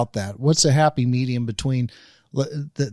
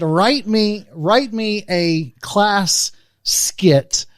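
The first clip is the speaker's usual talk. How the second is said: louder, higher, slow emphatic dictation; deliberate retold command; 6 semitones higher, 5 dB louder, much slower than usual. Dad retelling his order to ChatGPT, deliberate and emphatic. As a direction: spell out the order with mock gravity, louder.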